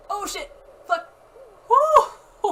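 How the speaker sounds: startled high yell while falling; losing balance. Real, not acted. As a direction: cry out mid-fall, then whoop. Didn't break my leg.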